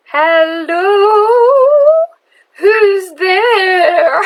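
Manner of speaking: put-on high, loud old witch calling out; a witch calling through the door, high and eerie; creepy witch voice. Narrator voicing the witch behind the door, high and loud.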